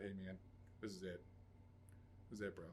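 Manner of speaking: soft, resigned farewell. Retold or imitated. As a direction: say it quietly, given up. Resigned call to friend.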